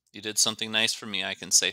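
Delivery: ordinary delivery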